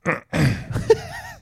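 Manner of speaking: squeaky high throat clear; funny throat clear. Real, not acted. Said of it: "That was a funny throat clear".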